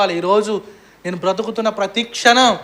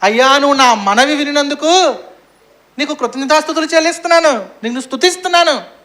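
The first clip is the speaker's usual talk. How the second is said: retold fervent prayer; 6 semitones higher, 5 dB louder than usual. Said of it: "ఇలా ప్రార్థన చేశాడు".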